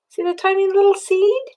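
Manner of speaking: ordinary delivery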